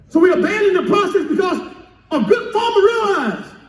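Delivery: high-pitched, urgent sermon delivery; impassioned preaching. Real, not acted. Pastor warning congregation about abandoning the process, impassioned preaching.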